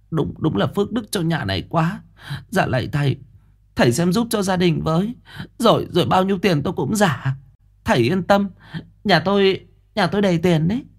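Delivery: high, delighted and eager; overjoyed mother. A narrator's reading. Mother thanking the master, overjoyed and high. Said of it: "mừng rỡ, mắt sáng rực".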